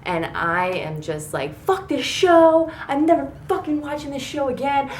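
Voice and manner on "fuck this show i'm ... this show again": screaming at the TV. Retold or imitated. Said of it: "I'm, like, screaming at Gina".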